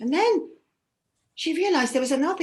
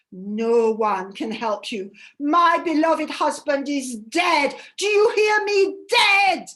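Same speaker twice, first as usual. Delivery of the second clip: louder and higher, wild with grief; a widow screaming her grief at her sister; raging grief; 3 semitones higher, 5 dB louder than usual. Acted. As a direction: shout the loss, landing hard on dead. Ereshkigal raging over dead husband.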